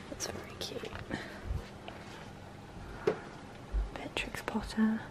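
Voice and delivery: hushed whisper among the shelves; shop whisper. Real, not acted. Browsing a quiet homeware shop, hushed whisper.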